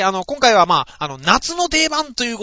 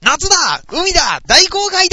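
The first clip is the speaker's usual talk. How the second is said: 元気な掛け声; 9 semitones higher, 6 dB louder than usual. Acted. ラジオのお題発表の掛け声.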